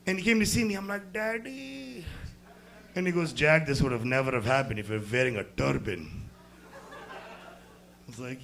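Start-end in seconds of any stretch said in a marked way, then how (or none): 1.1-2.0 soft, high mock-pitiful call; hushed, pitched-up imitation of a helpless child; small child-like voice. Hurt son sees estranged dad. 3.3-6.2 put-on low Indian-accented dad. Imitating his Indian father.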